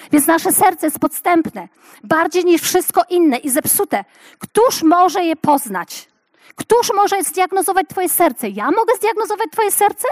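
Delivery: impassioned preaching. Acted. Preacher urging women to let God diagnose hearts. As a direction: rise in fervour with each question.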